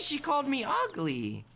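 a touch of fake heartbreak; mock hurt. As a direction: play the pouty victim. Repeats the insult, wounded.

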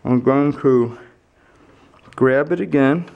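flashlight in mouth. Real, not acted. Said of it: "I have the flashlight in my mouth".

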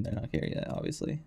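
ordinary delivery

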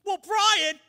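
high, loud accusing voice; Jeremy turns on his friend; loud high taunt; Jeremy snapping. Acted. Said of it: "Jeremy's like".

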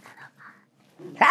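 slow breathy whisper; trailing whispered excuse; faint whisper. Retold or imitated. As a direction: barely breathe the words. Sighing excuse to herself.

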